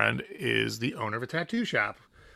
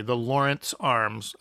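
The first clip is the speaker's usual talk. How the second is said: said clearly; slower than usual. Shown as a demonstration. Correcting himself for the listener, slow and clear. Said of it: "sorry, I said that a little unclearly".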